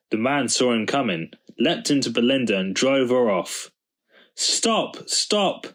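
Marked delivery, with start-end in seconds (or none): 4.3-5.7 high yelling after the car; high-pitched chasing yell. A narrator's reading. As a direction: yell it as he runs. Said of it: "yelled Daddy".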